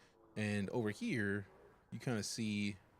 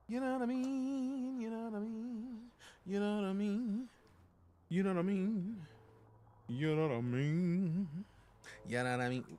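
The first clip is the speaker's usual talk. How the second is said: high silly voice; 12 semitones higher than usual. Pretend.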